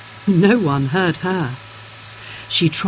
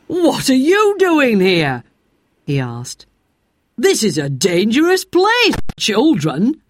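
slow, firm man's question; a driver scolding lightly; stern driver; 4 semitones higher than usual. A narrator's reading. Engine driver telling off the children, stern.

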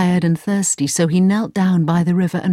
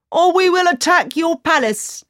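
threatening shout from the crowd; 10 semitones higher than usual. Acted. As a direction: yell the threat. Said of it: "shouted one man".

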